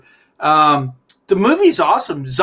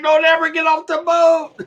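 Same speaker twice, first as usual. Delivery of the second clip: high yelled warning from the boat; panicked shout; 9 semitones higher than usual. Retold or imitated. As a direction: yell it in a panic.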